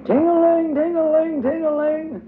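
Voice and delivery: very high, loud sing-song bell call; dinner bell ringing. A narrator's reading. Ra rings the pretend dinner bell for everyone, high sing-song call. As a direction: ring out like a little hand bell. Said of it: "shaking her hand up and down, and saying".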